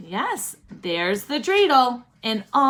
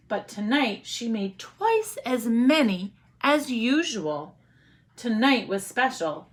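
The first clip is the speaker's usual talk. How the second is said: usual speaking voice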